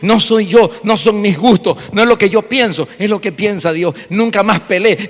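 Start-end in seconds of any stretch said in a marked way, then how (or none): none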